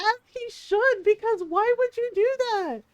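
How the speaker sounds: high-pitched indignation; indignant scolding. Retold or imitated. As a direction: scold in retold disbelief. Scolding dad, retold.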